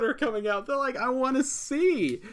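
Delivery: high, loud exasperated rant; angry venting. Real, not acted. Subscriber missing the films they want, exasperated rant. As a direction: pitch way up, pleading with frustration. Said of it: "I was that angry".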